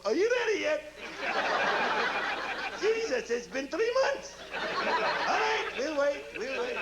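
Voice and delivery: put-on high, laughing generic Arabian accent; mock Iraqi accent. Iraqi soldier calling to the Americans, mock Arabian accent. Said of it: "Iraqi accent?".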